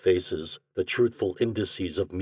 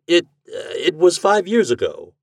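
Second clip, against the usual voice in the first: high, loud, stumbling reply; flustered and caught out; hurried; 10 semitones higher, 5 dB louder than usual. A narrator's reading. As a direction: blurt out a hasty excuse. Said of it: "said Mister Breed hurriedly".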